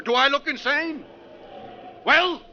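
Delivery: high, sharp indignant demand; defiant challenge. Acted. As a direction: dare them to answer. Willard challenging the others.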